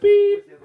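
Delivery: high, steady vehicle beep; reversing beep. Pretend. Host teasing someone backing away, high reversing beep. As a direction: sound like a truck backing up.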